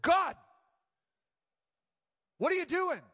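loud, high, demanding call upward; angry cry to God. Retold or imitated. Pastor recalls crying out to God in anger. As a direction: confront God with the question. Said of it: "I said".

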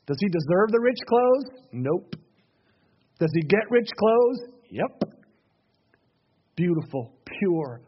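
ordinary talk, nothing marked

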